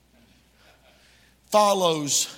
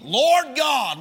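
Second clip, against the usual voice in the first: high, loud exclaimed cry; exclamation; 10 semitones higher, 4 dB louder, much slower than usual. Acted. Preacher quoting Jeremiah to his congregation, exclaiming. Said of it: "with an exclamation point".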